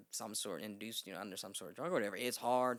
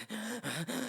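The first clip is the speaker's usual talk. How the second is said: hyperventilating; 11 semitones higher, 4 dB louder than usual. Retold or imitated. Acts out hyperventilating.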